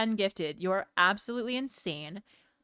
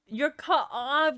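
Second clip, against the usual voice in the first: mock scolding; 6 semitones higher, slower than usual. Playful scolding.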